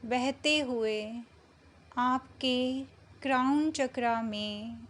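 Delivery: slow meditation voice with long pauses between phrases; unhurried, measured meditation guidance; guided meditation. Acted. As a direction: guide them gently into calm, drawing out the words, phrase by phrase.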